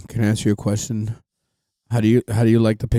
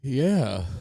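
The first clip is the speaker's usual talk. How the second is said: usual speaking voice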